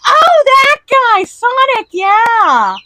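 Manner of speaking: high, loud, slowed gush of recognition; excited recognition. Retold or imitated. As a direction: gush like you finally recognise him. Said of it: "I'd be like".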